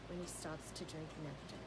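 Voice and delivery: ordinary delivery, nothing marked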